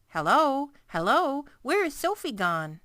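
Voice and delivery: worried parents calling out loud. Retold or imitated. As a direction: call out as if searching.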